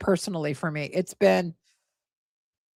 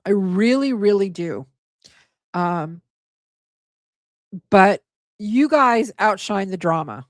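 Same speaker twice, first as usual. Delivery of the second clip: ordinary talk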